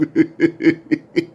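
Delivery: high staccato giggle; imitated laughter. Rapper still amused by the joke, imitated laughter. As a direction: mimic his leftover laughing fit. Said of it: "This man was just laughing his butt off".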